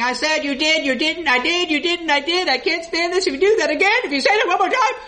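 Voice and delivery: mock bickering that builds from tense to high and heated; mock quarrel. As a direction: build a petty argument until it nearly shouts. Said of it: "When they're screaming and fighting".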